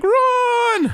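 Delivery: loud, very high-pitched cry of warning; mock yell. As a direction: imitate a guy yelling for everyone to flee. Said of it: "The guy yells, like".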